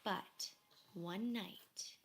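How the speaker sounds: usual speaking voice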